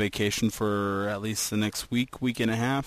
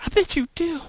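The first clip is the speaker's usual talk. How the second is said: much higher, louder, fast squeaky voice; high, loud squeaky mock voice; silly high voice; 8 dB louder than usual. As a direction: squeal it out like a cartoon.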